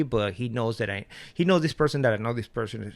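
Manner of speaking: usual speaking voice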